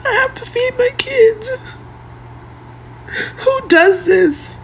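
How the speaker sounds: high, breaking sob on the words; real tears, very slow at the end; crying. Real, not acted. Robbed mother asking viewers, crying high and slow. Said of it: "Why are you crying?".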